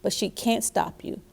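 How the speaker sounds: put-on animated, emphatic reading to children; expressive picture-book narration with loud key words; storybook read-aloud. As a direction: read it out big and encouraging for little listeners.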